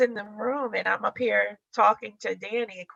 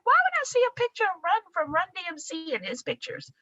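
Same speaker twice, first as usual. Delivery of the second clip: baffled disbelief; 9 semitones higher than usual. Retold or imitated. Baffled reply to husband.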